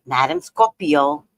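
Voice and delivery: ordinary talk, nothing marked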